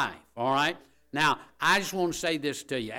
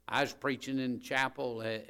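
usual speaking voice

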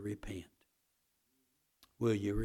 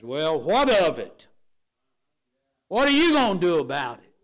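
loud, higher mock challenge; flippant defiance; 6 semitones higher, 13 dB louder than usual. Sinner refusing to repent, loud flippant challenge. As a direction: throw it back loud and cocky. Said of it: "that flippant attitude".

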